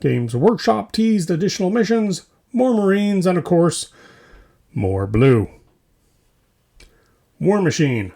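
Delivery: normal talking voice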